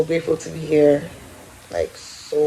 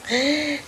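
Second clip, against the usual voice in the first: mock gasp; 10 semitones higher, 3 dB quieter than usual. Mock shock at her own age.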